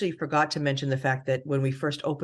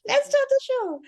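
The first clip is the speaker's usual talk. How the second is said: Heidi Klum impression; 11 semitones higher than usual. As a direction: announce the show like the supermodel host. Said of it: "It's Heidi".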